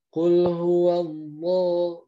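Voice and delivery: Kul huwallah slow, loud, with a heavy full lam; thick lam. Shown as a demonstration. Teacher demonstrates the thick lam to students. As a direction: recite Kul huwallah slowly and boldly. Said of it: "itu baru tebal lamnya".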